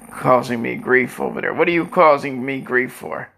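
normal talking voice

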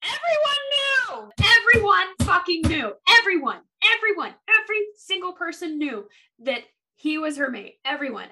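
fed-up rant, punching everyone; real rant. Real, not acted. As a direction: vent at everyone who knew. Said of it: "okay, I'm gonna rage here for a second".

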